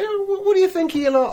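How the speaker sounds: high, fast quoted question; quoting a customer. Retold or imitated. Customer quizzing the taxi driver, high and quick. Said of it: "He'd say".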